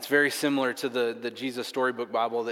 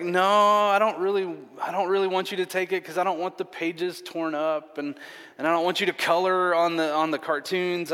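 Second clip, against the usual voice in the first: higher, louder mock protest; reluctant dad; 7 semitones higher, 5 dB louder than usual. Dad guarding his childhood Bible from daughter, reluctant. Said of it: "my gut reaction was no".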